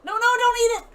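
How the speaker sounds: high urgent yell; alarmed shout. Real, not acted. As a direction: shout to stop her. Pet eating wrong item.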